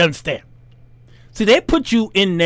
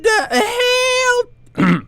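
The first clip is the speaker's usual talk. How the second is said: very high cracked shriek of disbelief; shocked squeal. Retold or imitated. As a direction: shriek it high like your voice broke. Shocked high-pitched outburst.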